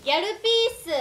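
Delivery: bright, high gyaru call. Shown as a demonstration. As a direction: shout it like a hyped gyaru. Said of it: "読み方もギャルでお願いします".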